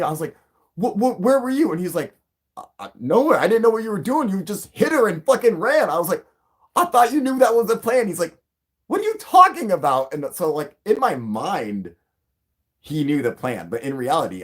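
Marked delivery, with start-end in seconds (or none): 0.7-1.7 high, loud stammering disbelief; retold bewildered question. Own bewildered reply, acted out. 2.6-5.9 high, loud defensive exclaiming; retold bewildered protest. Friend's defensive protest, acted out. 6.7-8.1 high-pitched, loud disbelief; retold indignant protest. Own protest in acted quarrel. 8.9-10.1 high, loud baffled outburst; retold incredulous retort. Friend's incredulous retort, acted out.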